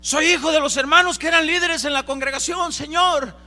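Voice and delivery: loud, high pleading cry; desperate plea. Acted. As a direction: beg the Lord at full voice, high and loud. Hijos suplicando al Señor. (súplica desesperada a gritos)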